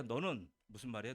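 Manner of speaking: ordinary talk, nothing marked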